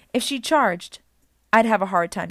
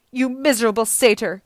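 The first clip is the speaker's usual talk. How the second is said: raised, loud snarling insult; enraged snarl; 4 semitones higher, 8 dB louder than usual. A narrator's reading. Medusa rages at Grover after his blow, snarling. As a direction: spit it out in rage. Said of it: "she snarled".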